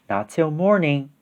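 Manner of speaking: soft, restrained refusal; gentle mother bear. A narrator's reading. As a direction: answer the eager cub softly. Mama Bear answers the cub.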